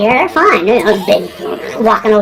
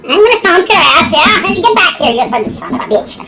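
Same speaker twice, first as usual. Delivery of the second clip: higher-pitched Irish tough talk; mock Irish threat; 6 semitones higher than usual. Irishman stomping a spider, hammed-up Irish threat. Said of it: "they're stomping her ass".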